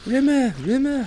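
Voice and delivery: high drawn-out Rimmer call; Rimmer impression. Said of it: "weird territory with that impression".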